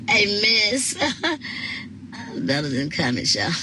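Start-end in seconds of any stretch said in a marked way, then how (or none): 1.0-2.3 high, soft airy giggle. Real, not acted. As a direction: let a small high laugh escape. Laughing at a viewer's comment.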